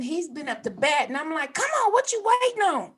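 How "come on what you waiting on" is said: high-pitched heckle from the stands; urging on. Retold or imitated. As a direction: push the batter along in a high voice. Mother urging batter from stands.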